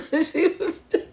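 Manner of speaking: laughing it out, high and loud; can't hold back the laugh; giggling through the words. Real, not acted. Laughing while telling the story.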